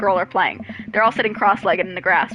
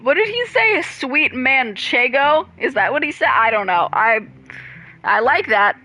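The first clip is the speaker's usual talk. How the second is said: normal talking voice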